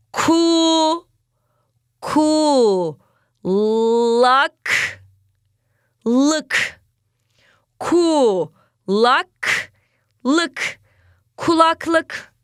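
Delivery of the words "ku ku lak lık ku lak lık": each syllable split off slowly with a pause. Shown as a demonstration. Teacher sounding out syllables for children, slow and separated. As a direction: break the word into slow separate beats. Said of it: "sakince, yavaşça okuyoruz".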